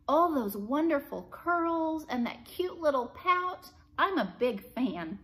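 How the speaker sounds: delighted. A narrator's reading. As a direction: swoon over the curls and pout. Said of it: "clasping her hands together with delight".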